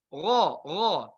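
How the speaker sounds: gha, gha deep and heavy in the throat; heavy ghain; thick ghain. Shown as a demonstration. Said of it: "tidak ada gha".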